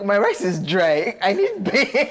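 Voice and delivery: hammed-up begging, breaking into laughter; mock wailing plea. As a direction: beg theatrically, pitch climbing on beans. Jokingly begging for beans, shrieking.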